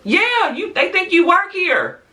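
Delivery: high-pitched, laughing exasperation; incredulous mocking. Real, not acted. Calling out club regulars, incredulous mocking. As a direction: squeal it in mocking disbelief.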